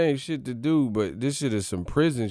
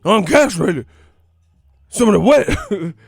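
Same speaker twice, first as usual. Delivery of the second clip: loud, high crazed begging, breaking into laughs; Tyson Fury; 8 semitones higher, 10 dB louder than usual. Acted. Boxer demanding wild boar, loud manic high cries. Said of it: "That's how Tyson Fury be".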